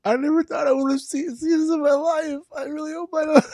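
high, squeaky distress. Real, not acted. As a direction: squeal it through nervous laughter. Watching the gory scene in dismay.

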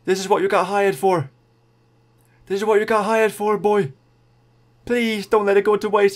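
high, loud urging shout; excited cheering. Real, not acted. Gamer shouting at his rider Quintana to push, high and loud. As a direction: roar your rider up the climb.

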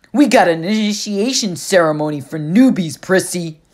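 loud husky bully growl. Acted. Clarice threatens the newbie, loud husky growl. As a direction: snarl the invitation like a threat. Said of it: "Clarice growled".

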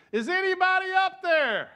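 high, loud desperate cry; calling out. Acted. Hanging man calling out again, high and loud. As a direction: shout up the cliff again.

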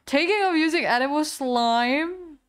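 loud, high disbelief; incredulous question. Real, not acted. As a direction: exclaim it as an amazed question. Reacts to absurd quiz answer.

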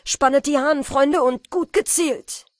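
loud commanding shout. A narrator's reading. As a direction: bellow the order at them. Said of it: "schrie der Jäger mit starker Stimme".

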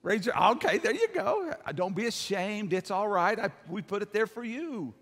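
usual speaking voice

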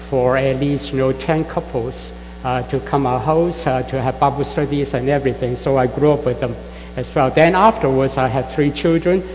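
normal talking voice

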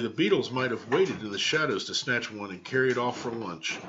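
ordinary delivery, nothing marked

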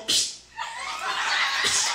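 hissed psst to get attention; secretive little hissed call, repeated; furtive psst. Acted. Candy beckoning the preacher as he passes, furtive psst.

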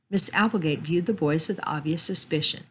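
ordinary delivery

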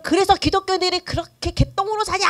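high-pitched jeering challenge, as an imitated critic; mock indignant accusation. Non-believer scolding Christians for how they live, mock indignant. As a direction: throw it out like an outraged accuser.

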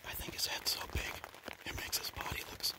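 hushed, unvoiced-sounding whisper from the blind; excited news kept to a whisper; hunter's whisper. Real, not acted. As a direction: whisper low so the animals don't hear.

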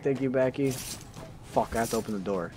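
ordinary delivery

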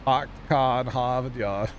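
put-on slow, drawn-out Boston accent. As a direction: drop the R's like a Bostonian. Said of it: "the accent's great".

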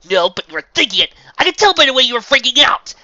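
high, loud heated yelling; furious shouted retort; shouting. A narrator's reading. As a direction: hurl it back mid-argument. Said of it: "the girl shouted".

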